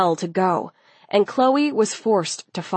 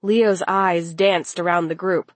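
usual speaking voice

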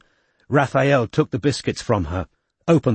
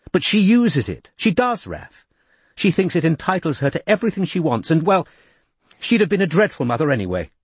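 defiant; 7 semitones higher than usual. A narrator's reading. Izzy defending her harsh words to Raph, defiant and higher. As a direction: dig in and defend her view. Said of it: "said Izzy defiantly".

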